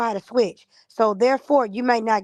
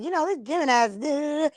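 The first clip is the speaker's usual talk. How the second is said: a hint of a whiny tattletale; mock tattling Capricorn; 6 semitones higher than usual. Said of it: "Capricorn will try to go tell the Aries".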